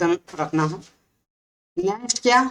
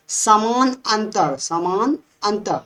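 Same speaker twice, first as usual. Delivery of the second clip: slow emphasis; much slower than usual. Shown as a demonstration. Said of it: "उसको बोलेंगे समांतर श्रेणी".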